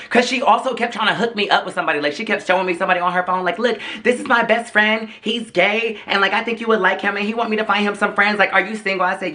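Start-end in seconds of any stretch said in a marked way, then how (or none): 3.6-9.0 light mock party girl. Mimicking the woman pitching her friend.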